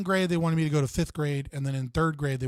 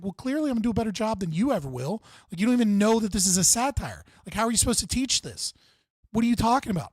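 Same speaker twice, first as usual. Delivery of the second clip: retold indignant rant; 6 semitones higher, faster than usual. His rant back at teacher.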